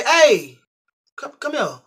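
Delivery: loud, high call across the yard. Retold or imitated. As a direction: summon him sharply. Calling the man over.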